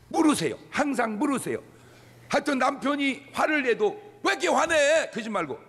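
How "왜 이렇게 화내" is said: high, sharp mock irritation; acted high-pitched exasperation; irritated retort. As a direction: snap back like an annoyed spouse. Voicing angry retort to husband.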